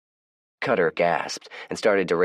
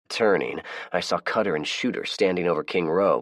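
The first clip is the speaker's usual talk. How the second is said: usual speaking voice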